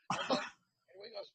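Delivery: usual speaking voice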